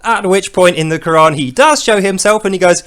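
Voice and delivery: ordinary talk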